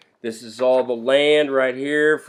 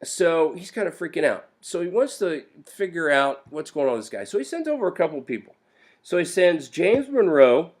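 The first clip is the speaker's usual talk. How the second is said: ordinary talk, nothing marked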